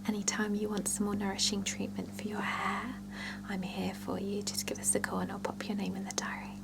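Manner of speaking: ordinary delivery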